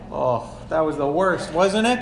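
usual speaking voice

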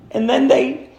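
usual speaking voice

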